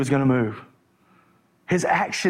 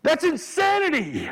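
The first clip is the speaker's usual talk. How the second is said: fired-up preacher at the pulpit; full-force preaching; 11 semitones higher than usual. Acted. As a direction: preach it hard to the crowd.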